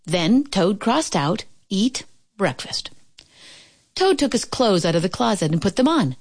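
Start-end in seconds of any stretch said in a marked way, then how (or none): 1.7-2.9 whispered list item; hushed read-out. A narrator's reading. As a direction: whisper it while ticking off a task. Toad crosses out the words.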